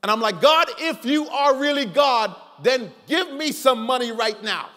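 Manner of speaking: normal talking voice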